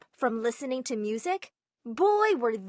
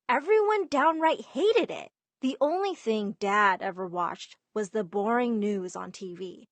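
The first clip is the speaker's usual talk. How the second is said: ordinary delivery, nothing marked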